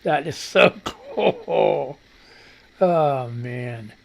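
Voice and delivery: breathless slow laughing oh my God; helpless laughter at the meme; laughing hard. Real, not acted. Cracking up at a meme, slow and laughing.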